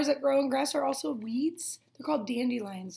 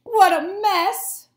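slow, loud, higher cry; shocked shout; 6 semitones higher, 9 dB louder, much slower than usual. Acted. Grass crying out at the mowed mess. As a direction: yell it in dismay.

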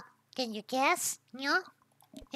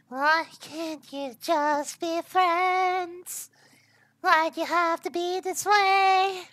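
drawn-out, higher, melodramatic plea; mock pleading; 5 semitones higher, 4 dB louder, slower than usual. Player pleading with chemicals that won't react, mock pleading. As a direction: wail it in mock despair, getting louder.

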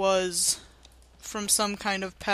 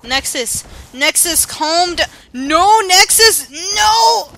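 rising higher and louder in panic; frantic shouting; 8 semitones higher, 14 dB louder, slower than usual. Real, not acted. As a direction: yell at her to stop. Character runs off.

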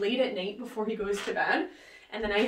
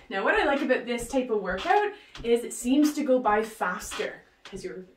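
normal talking voice

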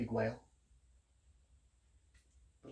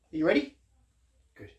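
usual speaking voice

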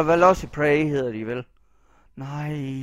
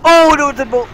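loud, high gasp of relief; real relieved exclamation; relieved outburst; 12 semitones higher, 15 dB louder than usual. Real, not acted. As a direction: blurt it out with relief. Relief after close escape.

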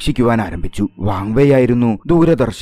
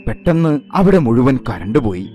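ordinary delivery

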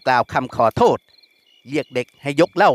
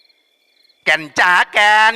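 high, loud call across the shop; 7 semitones higher, 5 dB louder, much slower than usual. Acted. Thug's men shouting for Kaen from their table. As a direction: call her name over the room. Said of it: "เสียงเรียกขัดขึ้น".

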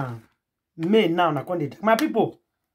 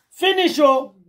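excited exclaim; 7 semitones higher, 4 dB louder, slower than usual. Real, not acted. Exclaiming the climax of gist.

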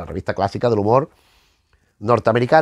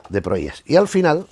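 ordinary talk, nothing marked

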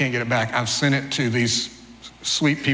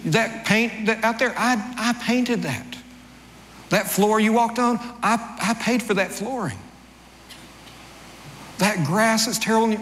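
mock self-righteous; 8 semitones higher than usual. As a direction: insist it's still your house. Speaker defending his claim to house.

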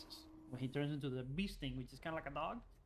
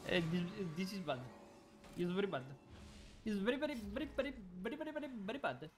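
higher, sing-song fake Indian accent; mock Indian accent; 3 semitones higher, faster than usual. Gamer joking about how very bad it is, mock Indian accent. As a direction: put on an amateurish Indian accent. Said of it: "what accent that is. I think it's Indian".

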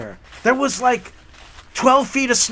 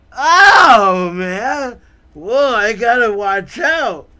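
pained dude voice; 2 semitones higher, 6 dB louder, much slower than usual. Retold or imitated. Hits head on ceiling.

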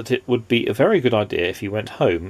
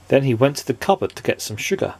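usual speaking voice

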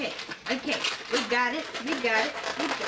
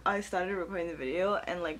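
ordinary talk, nothing marked

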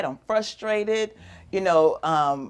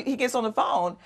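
ordinary talk, nothing marked

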